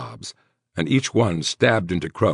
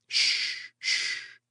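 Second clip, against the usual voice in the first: whispered, gentle hushing; soothing shush; 8 dB quieter than usual. A narrator's reading. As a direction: hush her softly. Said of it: "he soothed".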